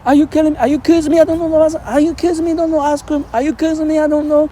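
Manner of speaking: high, loud, repeated disbelief. Retold or imitated. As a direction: ham up the defensive denial. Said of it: "He said".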